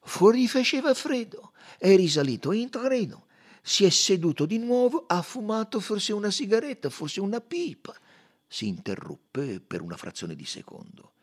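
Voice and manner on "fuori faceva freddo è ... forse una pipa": higher, louder detective voice walking through events; detective coaxing memory, pitched up; Poirot reconstructing. Acted. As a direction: paint the scene for the witness.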